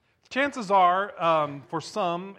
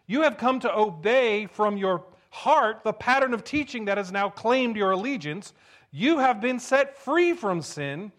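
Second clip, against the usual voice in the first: scripture reading; 3 semitones higher, 4 dB louder than usual. Acted. Pastor reading scripture to the congregation, solemn. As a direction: read the verses with weight.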